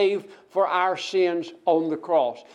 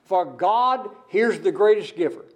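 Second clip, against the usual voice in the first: normal talking voice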